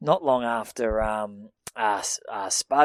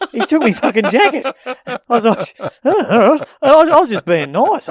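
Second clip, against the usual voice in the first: higher and louder, laughing through the words; 9 semitones higher, 8 dB louder than usual. Real, not acted. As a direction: laugh while you tell it. Laughing while telling story.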